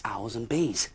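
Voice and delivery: ordinary delivery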